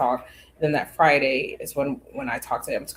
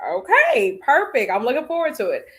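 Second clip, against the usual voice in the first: eager agreement; 10 semitones higher, 3 dB louder than usual. Retold or imitated. Him agreeing to the date.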